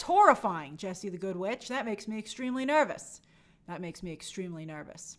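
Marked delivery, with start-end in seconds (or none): 3.7-5.2 breathy whisper, much softer. Shown as a demonstration. Host repeating his worry as a whisper. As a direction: hush it like a secret worry. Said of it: "said again whispering".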